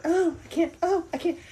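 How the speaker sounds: high, hammed-up swooning protest; mock overwhelmed. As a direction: play a fussy top model who can't cope. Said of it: "he spent the night going".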